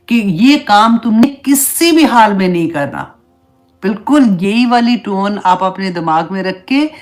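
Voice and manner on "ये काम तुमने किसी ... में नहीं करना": firm parental order, emphatic on किसी; commanding parent. Acted. Parent giving a child a strict order, commanding tone. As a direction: lay down the law like a parent. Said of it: "लेकिन हमारी tone ऐसी होती है".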